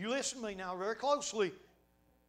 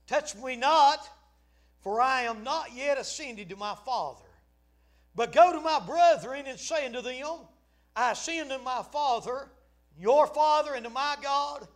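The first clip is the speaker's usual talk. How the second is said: loud, raised, proclaimed Bible verse; dramatic scripture reading; 8 semitones higher, 13 dB louder than usual. Acted. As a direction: declaim the verse with ringing force. Reads scripture aloud to congregation.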